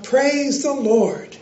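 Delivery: slow, drawn-out mock shout of praise. A narrator's reading. Elderly lady shouting from her porch, mild slow shout. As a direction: call it out slowly from the porch. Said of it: "and shout".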